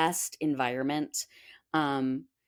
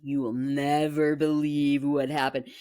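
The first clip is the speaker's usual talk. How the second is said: seething; 2 semitones lower than usual. Retold or imitated. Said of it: "I was seething".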